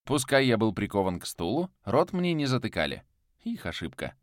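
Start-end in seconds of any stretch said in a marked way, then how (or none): none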